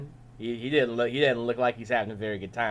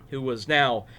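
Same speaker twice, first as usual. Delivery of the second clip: usual speaking voice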